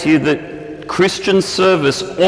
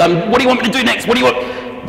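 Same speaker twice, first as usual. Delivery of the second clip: eager pig; 7 semitones higher, much faster than usual. Retold or imitated. Eager pig voiced in story.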